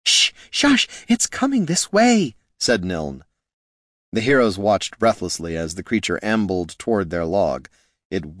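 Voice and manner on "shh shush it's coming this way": high, alarmed shushing; high-pitched urgent warning; urgent hushing. Acted. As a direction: hush the others in a panic. Hushing party as creature approaches.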